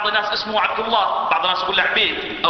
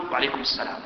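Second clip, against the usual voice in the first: half-swallowed, much softer "وعليكم السلام"; mumbled reply; 5 semitones lower, 7 dB quieter, faster than usual. Shown as a demonstration. Replying to a greeting with a careless mumble. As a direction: toss off "وعليكم السلام" under your breath, barely voiced. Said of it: "رد التحية لفظا وأداء".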